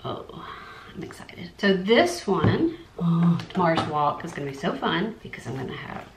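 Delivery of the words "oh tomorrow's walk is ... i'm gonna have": ordinary talk, nothing marked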